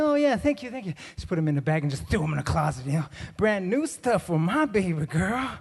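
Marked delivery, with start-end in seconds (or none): 0.0-0.9 high, put-on polite thanks; overly polite mock thank-you; mock gratitude. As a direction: thank them politely, without meaning it. Mock polite thanks for old clothes.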